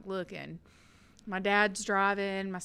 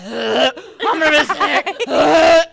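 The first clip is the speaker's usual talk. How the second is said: pain groan; 7 semitones higher, 18 dB louder than usual. Retold or imitated. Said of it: "and I'm like".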